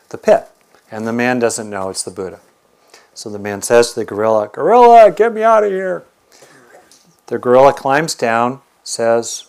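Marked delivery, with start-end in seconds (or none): 4.5-6.0 high, loud shout upward; desperate call. Acted. Hunter stuck in the pit shouting up to the gorilla, desperate high shout. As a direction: yell up from the bottom of a pit. Trapped man calls up from pit.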